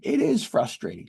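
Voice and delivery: high, emphatic exasperated agreement; acted frustration. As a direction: burst out in heated agreement. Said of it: "that must be frustrating".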